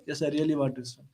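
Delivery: reply softly and low, dropping away at the end; quiet assent. Retold or imitated. Merchant's answer in retold dialogue.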